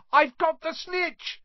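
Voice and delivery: high, drawn-out yell of triumph; high-pitched jubilant cry; triumphant shout. A narrator's reading. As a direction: shout it out high, stretching the words. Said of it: "he shouted".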